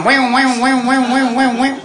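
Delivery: wailing like cartoon Popeye; Popeye impression. Imitating the kids crying out like Popeye. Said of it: "כמו פאפאי".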